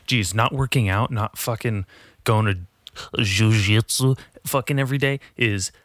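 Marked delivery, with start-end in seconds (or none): none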